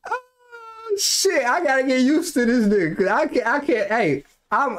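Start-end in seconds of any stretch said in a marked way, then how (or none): 0.1-1.0 soft, high moan of dismay; mock-tearful "oh"; high whimpering groan.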